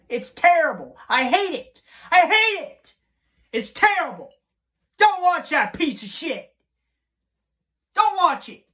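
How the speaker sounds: high, loud, real angry shouting; outraged rant at full volume; furious yelling. Real, not acted. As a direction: scream it, fed up with the episode. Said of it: "I'm fucking pissed off".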